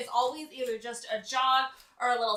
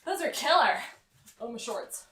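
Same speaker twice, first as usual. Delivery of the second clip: ordinary talk, nothing marked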